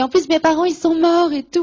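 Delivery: high, loud, upset retelling of her own tearful words; the distress of a girl in tears at church, high and loud. Retold or imitated. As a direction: blurt it out as if tearing up.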